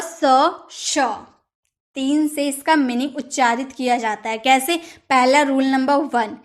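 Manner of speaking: normal talking voice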